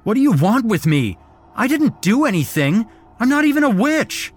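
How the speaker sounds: high, loud, fast desperate plea; frantic denial of being a witch; panicked pleading. A narrator's reading. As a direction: beg for your life in terror. Captive pleading with cult.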